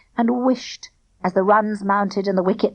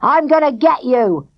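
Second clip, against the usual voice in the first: angry threat; 4 semitones higher, 4 dB louder than usual. A narrator's reading. Angry bowler threatens Barney.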